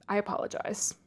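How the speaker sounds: ordinary delivery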